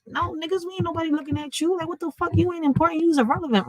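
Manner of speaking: high-pitched, dismissive comeback; scornful retort. Retold or imitated. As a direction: brush them off with mock disbelief. Her scornful retort to starers.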